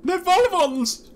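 high, slow squeaky cry; alarmed little creature. Acted. Owen the companion squealing in alarm, high and slow.

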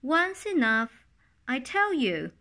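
loud, high, cross retort; the magpie losing her temper at the dove; angry magpie. A narrator's reading. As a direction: snap back, fed up with the dove. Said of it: "the magpie got angry and said".

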